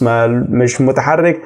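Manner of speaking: normal talking voice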